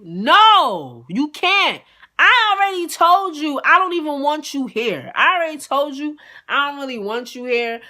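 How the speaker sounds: loud, high-pitched retold scolding; emphatic refusal. Woman refusing to share her bed with her guest, exasperated. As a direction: hammer the refusal home, starting at full volume.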